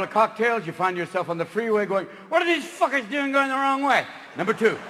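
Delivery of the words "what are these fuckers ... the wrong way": pitched-up baffled outrage at other cars; a drunk wondering why everyone's wrong; confused drunk driver. Acted. Drunk driver yelling at oncoming traffic, baffled.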